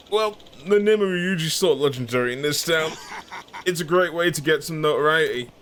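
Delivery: lightly voiced cocky crook; boastful impostor. Impostor explains himself.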